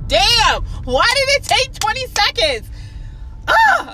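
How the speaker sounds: exasperated outburst. Real, not acted. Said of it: "I'm so slow".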